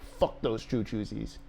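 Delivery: gruff dog voice. Pretend. Voicing his dog's reply to snack talk, slow low grumble. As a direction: voice the dog as a grumpy tough guy. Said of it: "He's like".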